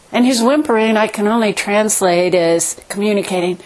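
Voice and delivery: normal talking voice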